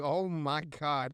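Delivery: slow, drawn-out disbelief; mock dismay. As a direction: stretch it out in exasperation. His exasperated reaction, drawn out.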